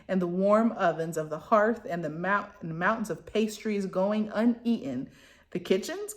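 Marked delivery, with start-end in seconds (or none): 5.5-6.1 choked, high-pitched disbelief; incredulous. A narrator's reading. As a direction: squeak it out in disbelief. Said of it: "choked out incredulously".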